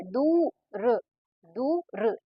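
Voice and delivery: दूर split, long weighted दू, then brief र; stress on दू. Shown as a demonstration. Teacher showing where stress falls, दू drawn out in दूर. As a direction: stretch the दू in दूर, drop a short र after it. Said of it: "द में जो है क्या है? ये बालाघात है".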